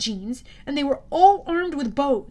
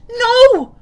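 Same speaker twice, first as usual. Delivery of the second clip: very high, loud desperate scream; Percy screaming as the arrows fly; 11 semitones higher, 15 dB louder than usual. A narrator's reading. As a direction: scream it in horror. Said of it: "I screamed".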